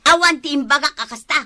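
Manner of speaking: loud, fast denial; heated denial. Acted. Wife denies his accusation, loud and heated. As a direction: cut him off, indignant.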